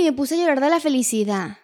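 ordinary talk

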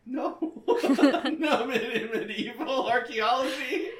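laughing. Real, not acted. Friend laughing at the medieval archaeology degree, loud laughter. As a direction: crack up while saying it.

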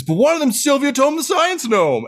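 high, hollered celebrity introduction; rousing announcement. Acted. Dwarf announces famous gnome to the crowd, rousing. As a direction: whip the crowd up for a cheer.

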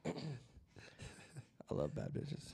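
very slow, low and soft; slow drawn-out line. Pretend. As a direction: drag the words out low.

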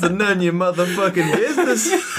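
punchline cracking up with laughter; laughing through it. Retold or imitated. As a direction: retell the line while laughing. Retelling punchline while laughing.

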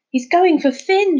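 high, loud alarmed shriek. A narrator's reading. Kyla shrieking to the others, alarmed. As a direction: shriek the warning. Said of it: "shrieked Kyla".

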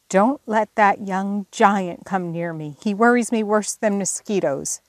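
usual speaking voice

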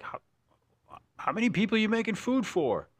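high, astonished question; amazed. Retold or imitated. Cook quoting his friend's amazed question, high and astonished. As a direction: marvel at the huge pile of food. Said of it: "you were like".

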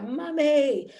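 put-on excited child calling. Son runs excitedly to his mother.

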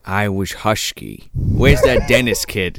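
put-on low, slow grumpy old man; Walter Matthau. Riffing on who's in the house, low slow Matthau voice. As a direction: drag it out in a low character voice. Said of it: "is Walter Matthau in the fucking house".

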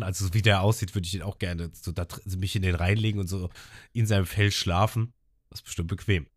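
usual speaking voice

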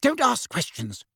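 high, sharp rebuke; snapped. A narrator's reading. Aunt shutting down Harry's question, snapping. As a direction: cut the question off curtly. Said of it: "Aunt Petunia snapped".

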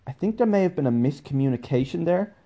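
high, tactful voice; carefully diplomatic. Retold or imitated. Correcting Madam Q about Sabine, carefully diplomatic. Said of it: "choose my words very carefully".

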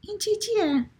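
put-on very high little-kid voice; relentless child asking in a high voice; questioning child. A narrator's reading. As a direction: pipe up high like a small child. Child interrupts with the same question.

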